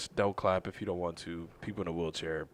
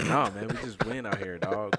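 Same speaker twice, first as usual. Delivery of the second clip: ordinary talk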